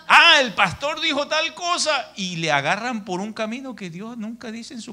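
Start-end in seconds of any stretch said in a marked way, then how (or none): 0.1-2.1 high, loud mocking whine of a know-it-all; mocking churchgoer. Pastor mocks stubborn old believers, high loud mocking voice.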